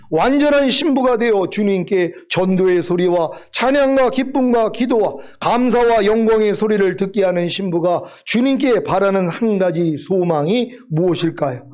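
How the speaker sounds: ordinary talk, nothing marked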